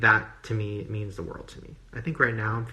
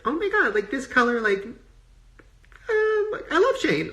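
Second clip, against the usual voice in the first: much higher, mock gushing fan; 6 dB louder, slower than usual. Vlogger imitating reviewers who gush about Shane, high mock gush. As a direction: squeal it like an overexcited reviewer. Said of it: "people are like".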